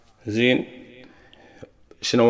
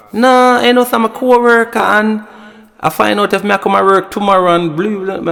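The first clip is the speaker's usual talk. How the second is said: put-on girlfriend's excuse; 9 semitones higher, 3 dB louder than usual. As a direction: imitate her innocent excuse. I want your man that call.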